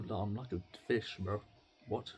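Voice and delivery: usual speaking voice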